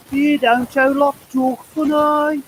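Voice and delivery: high, slow mock auntie voice with her accent; aunt mocking how Mansfield people talk, in a high, slow put-on accent; imitating auntie. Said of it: "she went".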